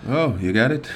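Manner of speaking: sarcastic. Real, not acted. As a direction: needle him, sarcastic disbelief. Mocking co-host's claim of understanding.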